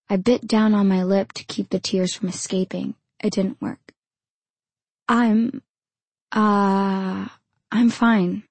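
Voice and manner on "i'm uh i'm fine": stammering. A narrator's reading. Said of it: "I stammered".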